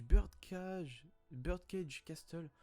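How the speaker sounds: slow, careful English accent. Shown as a demonstration. Vlogger reading out a manga title, in an English accent. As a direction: pronounce it with a small show-off English accent. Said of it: "avec mon petit accent anglais parfait".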